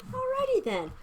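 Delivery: very high, put-on comic voice; cartoonish high-pitched sign-off; goofy catchphrase. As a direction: squeak it out as a silly catchphrase.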